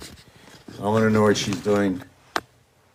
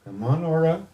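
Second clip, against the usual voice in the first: higher, softer sing-song call to the cat; coaxing a pet; 7 semitones higher, 5 dB quieter than usual. Real, not acted. Coaxing Aura the cat to come over, high and soft.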